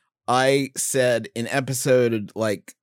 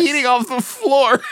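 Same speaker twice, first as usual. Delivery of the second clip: laughing; 12 semitones higher than usual. Real, not acted. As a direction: burst out laughing as you say it. Laughing at own story.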